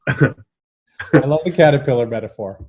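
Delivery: ordinary delivery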